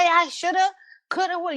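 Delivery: high teasing chant of "shoulda, coulda, woulda"; high mock sing-song. Shown as a demonstration. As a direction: pitch it way up like a playful chant. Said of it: "when I'm out there speaking".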